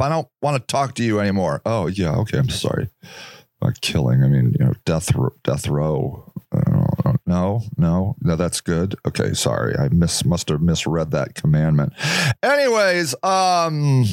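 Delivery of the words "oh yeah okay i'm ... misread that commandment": a much lower, sheepish mock believer voice; sheepish backpedal. Mock believer backpedals on killing, lower and sheepish. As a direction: back down low like a caught hypocrite.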